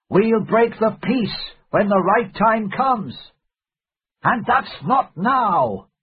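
high, slow, emphatic outburst; crying out. A narrator's reading. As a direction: declare it forcefully, stretching the words. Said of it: "he cried".

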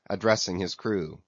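ordinary delivery

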